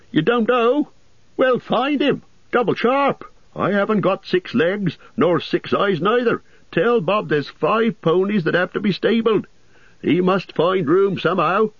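high, calling landlord voice; impatient innkeeper calling. A narrator's reading. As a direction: summon the lazy servant. Landlord gives orders.

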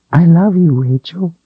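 very high, tiny doll voice. Acted. The doll speaks to Rachel.

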